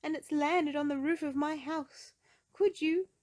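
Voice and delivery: high, loud pleading voice; Mister Small. Acted. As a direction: rush the plea, then get cut off. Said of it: "said a voice in the other end".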